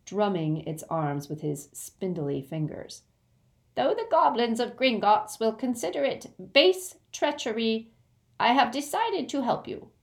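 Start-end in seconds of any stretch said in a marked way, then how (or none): 3.8-9.9 higher-pitched goblin voice; formal goblin. Acted. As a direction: declare it as a solemn goblin. Goblin announces his decision.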